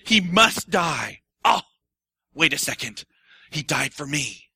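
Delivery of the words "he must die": very slow, weighty words; a touch of grave announcement; slow solemn. Retold or imitated. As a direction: lay each word down slowly. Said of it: "and he says".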